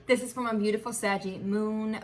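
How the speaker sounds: normal talking voice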